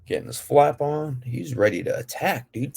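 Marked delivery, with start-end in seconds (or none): none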